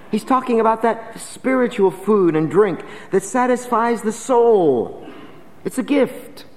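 preacher calling out the invitation to come; full-force preaching. Acted. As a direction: proclaim it to the whole congregation.

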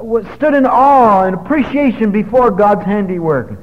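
high, forceful sermon delivery; exultant preaching. Real, not acted. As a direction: preach it high and loud, with rising wonder. Preacher exults over the changed life.

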